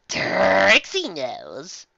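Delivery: slow, drawn-out Trixie voice; a touch of a smug magician. Acted. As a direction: stretch it out like a smug showman. Speaker voices Trixie character.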